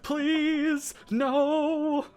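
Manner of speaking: very high, comic pleading; desperate to escape. Acted. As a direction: whimper it out as a hammed-up plea. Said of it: "hyper, like, concern about the situation".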